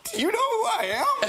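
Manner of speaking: very high, squeaky retold disbelief; mock incredulous. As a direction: squeal the question in exaggerated astonishment. Retold star-struck disbelief at idol's praise.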